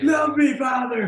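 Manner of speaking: high, loud anguished cry; dramatic shout. Acted. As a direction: wail it to the heavens. Dramatic plea in character.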